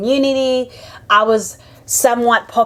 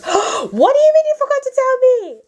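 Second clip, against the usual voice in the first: high, shrill-free disbelieving cry, louder; shocked outburst; 3 dB louder than usual. Retold or imitated. Retelling the sister's news, a high shocked outburst. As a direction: burst out in disbelief at the forgetful sister.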